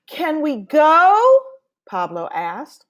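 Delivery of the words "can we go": high, loud, slow whiny ask; eager little boy pleading to go; impatient boy. Acted. Pablo begging Abuela to leave, high and whiny.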